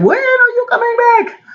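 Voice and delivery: high, pleading question; pleading godchildren. Retold or imitated. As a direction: mimic the eager kids asking. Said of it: "So it was like".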